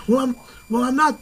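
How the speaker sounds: mock the furious lady insisting she's calm; mimicking angry driver. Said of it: "She said".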